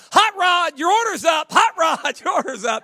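loud, high counter call; a mock server calling for Hot Rod; calling out an order. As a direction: shout the name across the restaurant.

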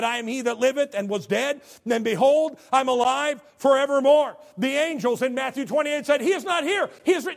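loud, high declaration; proclaimed scripture. Acted. Preacher quotes the risen Jesus to the church, loud. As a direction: ring it out triumphant.

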